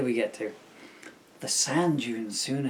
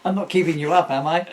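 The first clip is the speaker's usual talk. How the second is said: loud, teasing comeback to a chatty crowd; mock stage heckle; 4 semitones higher, 11 dB louder, faster than usual. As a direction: tease the talkers from the stage, loud. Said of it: "That's what I used to say".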